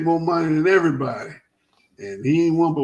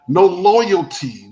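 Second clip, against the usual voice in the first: "no loyalty" drawn out, louder and higher; slow emphasis; 4 semitones higher, 5 dB louder, much slower than usual. Real, not acted. Host to co-host on player moves, slow emphatic "no loyalty". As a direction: lean hard and slow on "no loyalty". Repeating his slogan for emphasis.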